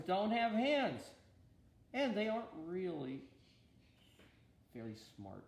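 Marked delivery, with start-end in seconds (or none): none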